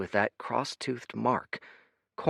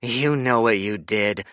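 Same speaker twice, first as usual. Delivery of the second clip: growled; 2 semitones higher, 5 dB louder than usual. A narrator's reading. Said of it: "she growled".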